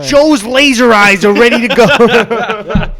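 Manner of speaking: high, very loud, slow parody voice; mock game announcement. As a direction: bellow it as an absurd power-up alert.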